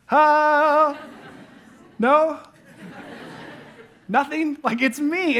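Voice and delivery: playfully hurt at not being recognized; mock puzzled Jesus. Preacher playing Jesus, unrecognized by Mary, mock bewildered. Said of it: "Imagine how that feels for Jesus".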